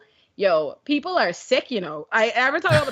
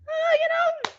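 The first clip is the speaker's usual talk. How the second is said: lightly voiced dad. Father voiced in story.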